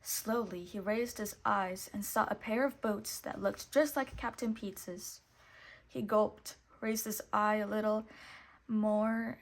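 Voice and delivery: ordinary delivery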